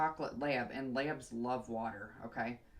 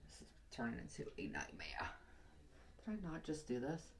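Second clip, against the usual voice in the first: whispered frustrated aside; a hushed grumble to yourself; 9 dB quieter than usual. Real, not acted. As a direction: breathe it out under your breath. Frustrated aside while working.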